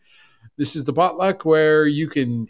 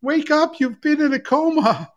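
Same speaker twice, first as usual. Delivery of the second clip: mock announcement; 7 semitones higher, 3 dB louder than usual. Mock message to coma patient.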